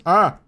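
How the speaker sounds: loud real sneeze; sneezing. Real, not acted. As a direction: let the sneeze burst out. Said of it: "I'm gonna sneeze".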